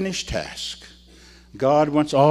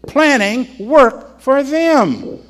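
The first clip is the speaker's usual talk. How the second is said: "Planning work for them" slow and higher; slow emphatic repeat; 9 semitones higher, 6 dB louder, much slower than usual. Shown as a demonstration. Preacher repeats the quote for emphasis, slowly. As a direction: say each word slowly and weightily. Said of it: "That's what it says".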